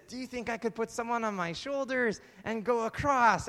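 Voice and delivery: high, loud showman's challenge; calling to a crowd. Acted. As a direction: project a dare to onlookers. Daredevil challenges excited crowd.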